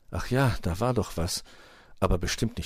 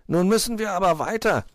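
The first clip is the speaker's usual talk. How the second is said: high, louder fox voice; urging fox; 12 semitones higher, 5 dB louder than usual. A narrator's reading. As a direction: hurry the company along, pitched up. Fox urges departure.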